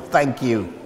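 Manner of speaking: low, soft, smooth thanks; telephone voice. Retold or imitated. Pastor answering a customer's compliment, deep telephone voice. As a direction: drop deep for a courteous phone reply. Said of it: "You've got a really good telephone voice".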